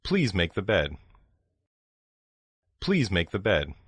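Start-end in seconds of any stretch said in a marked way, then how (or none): none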